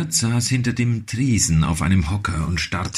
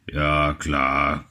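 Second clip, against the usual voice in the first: low grumpy grunt; 4 semitones lower than usual. A narrator's reading. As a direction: grunt it without looking up. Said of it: "grunzte der Wirt". (mürrisch grunzend)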